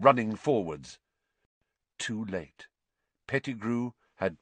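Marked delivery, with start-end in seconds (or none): none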